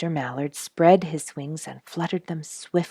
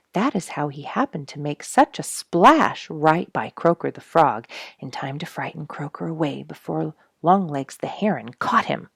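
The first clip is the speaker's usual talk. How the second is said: ordinary delivery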